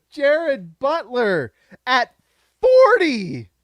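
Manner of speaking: slow, high, loud cry of joy; overjoyed wail; ecstatic yell. Real, not acted. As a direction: stretch it out in disbelief. Celebrating the pick.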